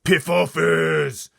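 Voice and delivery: slow, huge roaring giant; giant shouting. Acted. Blood Bottler shouting at the BFG, slow roar. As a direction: roar it out slowly. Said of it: "shouted the Blood Bottler".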